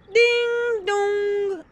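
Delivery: sung high ding dong like a bell; doorbell chime. A narrator's reading. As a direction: ring it out like a doorbell. Doorbell in the story. (sonnette chantée)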